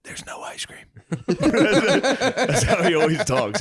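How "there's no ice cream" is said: unvoiced whisper with a laugh under it; mock Biden whisper. Host whispers Biden's ice cream joke. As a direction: hush it like a secret punchline. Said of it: "He whispers".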